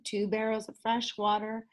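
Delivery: ordinary delivery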